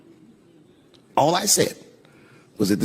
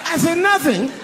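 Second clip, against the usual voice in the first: high-pitched feigned disbelief; mock astonishment; slower than usual. Husband hearing the box was empty, high mock surprise. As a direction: squeal it as if shocked.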